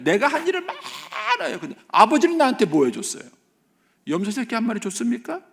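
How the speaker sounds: very high, whining mock complaint; hammed-up indignant son; aggrieved elder son. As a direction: demand your due in a high voice. Elder son complaining to his father.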